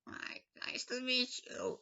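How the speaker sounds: high, slow, eerie whisper; creepy child whisper, drawn out; imitating Tony. Danny as Tony greeting Halloran in a slow eerie whisper.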